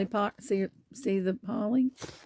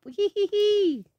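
gleeful "hee hee" in a high voice; drawn-out, high playful titter; high pretend giggle; 12 semitones higher, 5 dB louder, slower than usual. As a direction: giggle like a delighted cartoon piglet. Characters jump in puddles.

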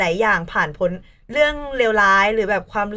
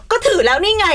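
retold defensive retort; 10 semitones higher, 5 dB louder than usual. Answering bandmate about the skirt, defensive. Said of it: "แต่หนูอารมณ์เสียไอ้รอบที่สามอะ".

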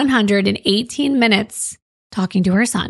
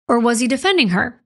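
ordinary talk

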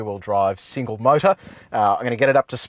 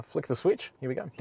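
normal talking voice

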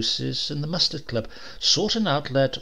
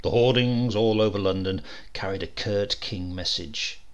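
usual speaking voice

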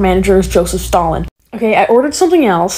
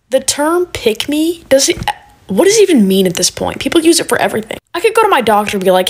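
usual speaking voice

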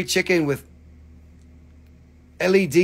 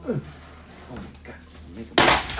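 whispered dismay; 3 semitones lower than usual. Real, not acted. Dismayed at crystal mess.

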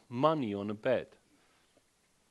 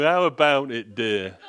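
loud, leering mock partner voice; mock come-on; 7 dB louder than usual. Mocking the bed partner who wants more, hammed-up and loud. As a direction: play the snoring partner's sleepy come-on. Partner's bedtime advance mimicked.

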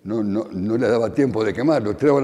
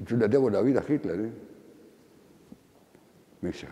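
ordinary delivery

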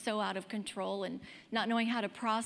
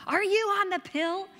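mother's shout, loud and much higher; angry mom shout; 10 semitones higher, 8 dB louder than usual. Retold or imitated. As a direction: mom yells the question in alarm at her daughter. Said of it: "she's like".

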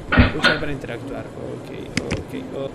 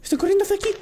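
alarmed high; 10 semitones higher, 4 dB quieter, much faster than usual. Real, not acted. Mannequins approaching, repeated lower.

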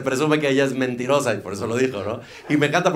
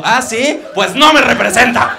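much higher, louder mock indignation; indignant TV host; 11 semitones higher, 12 dB louder than usual. TV host offended by the pope joke, shrill mock indignation. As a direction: sputter it like an offended pundit. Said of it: "Y Esteban Arce dijo".

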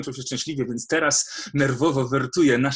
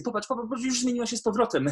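put-on much higher, bright eager coaxing to a child; excited coaxing; 11 semitones higher, much faster than usual. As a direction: point it out to her eagerly, pitch way up. Father cheering up crying daughter.

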